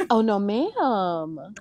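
very slow, drawn-out comic refusal; mock horrified refusal. Singer shaken by the viewers at home, drawn-out mock horror. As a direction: stretch each word in mock dismay.

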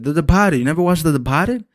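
The Departed said the Boston way, Depahted with no r; Boston accent. Retold or imitated. Imitating the family's Boston way of saying it. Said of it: "He's like".

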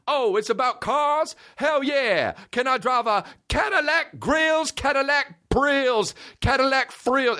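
lightly voiced hyped-up star. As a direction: gush like an overexcited rapper. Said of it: "he was like".

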